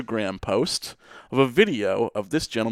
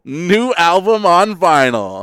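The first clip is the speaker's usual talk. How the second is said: excited tweet read; 7 semitones higher, 6 dB louder, slower than usual. Retold or imitated.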